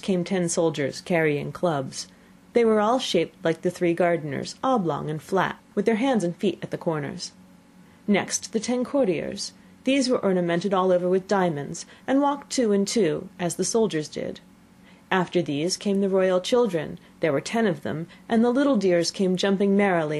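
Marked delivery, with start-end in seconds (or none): none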